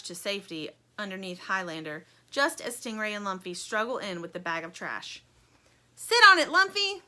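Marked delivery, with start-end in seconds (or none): 5.9-7.0 high, loud urgent cry. A narrator's reading. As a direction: shout the order. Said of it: "cries Stingray".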